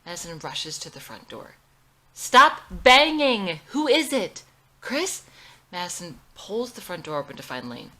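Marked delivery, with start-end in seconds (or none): 2.1-5.2 very loud, higher, slow shout; furious yell at whoever is knocking; angry shout. Acted. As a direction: bark it at the banging door.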